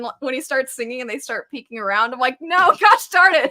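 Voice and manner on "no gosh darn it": loud, high exclamation through a laugh; exasperated outburst. Retold or imitated. Viewer protesting as the singing starts working, exasperated outburst. Retold exasperated reaction to show.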